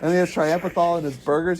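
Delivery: usual speaking voice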